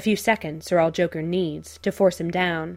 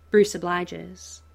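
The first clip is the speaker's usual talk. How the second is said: ordinary delivery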